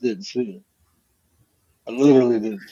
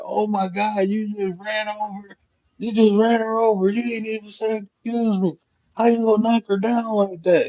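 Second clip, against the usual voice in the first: imitating her, higher and indignant; a mock scolding, pitched up; angry daughter; 8 semitones higher than usual. Daughter scolding him for knocking grandma down, mock indignant and high.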